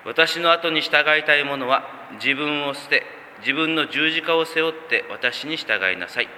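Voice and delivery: slow, solemn reading of Jesus' call; scripture reading. Acted. Pastor reads Jesus' words to the congregation, slow and reverent. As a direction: lay out each phrase with measured reverence.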